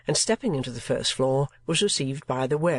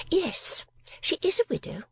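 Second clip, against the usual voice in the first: high, affected spinster voice; mincing lady voice. A narrator's reading. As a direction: play a fussy miniature painter. Miss La Creevy speaks.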